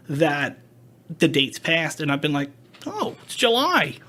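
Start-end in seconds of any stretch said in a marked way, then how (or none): 2.9-4.0 mild surprise. Retold or imitated. Date passing unnoticed.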